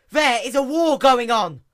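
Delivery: high, loud alarmed outburst; panicked cry. Acted. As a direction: blurt it out in alarm. Luna panics to her sister.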